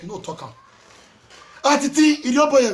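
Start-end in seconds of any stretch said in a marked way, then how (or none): 1.6-2.7 calling out. Real, not acted. Calling out Atiti.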